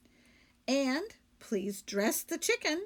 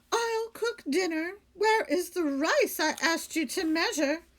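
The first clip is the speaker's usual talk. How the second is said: higher, louder dismayed lady; Missus Rogers; 8 semitones higher, 4 dB louder than usual. Acted. Lady of the house scolding her maid, high and dismayed.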